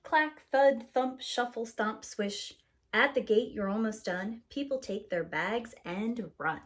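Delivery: ordinary delivery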